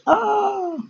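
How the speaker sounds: high, short mock shriek; mock scream. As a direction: shriek like it's scary.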